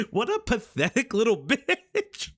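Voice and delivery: laughing through the insult; scornful laugh while saying it. Real, not acted.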